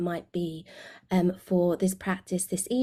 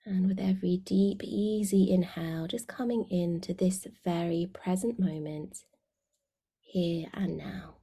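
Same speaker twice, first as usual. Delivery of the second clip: guided meditation. Real, not acted. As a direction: guide them gently inward, letting phrase endings sink away.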